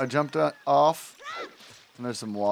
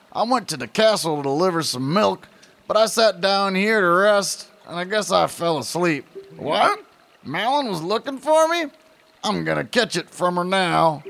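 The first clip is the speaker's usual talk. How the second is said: put-on high, drawling old prospector voice; 7 semitones higher, 4 dB louder than usual. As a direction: grumble like a cranky old miner. Reads Talon's dialogue.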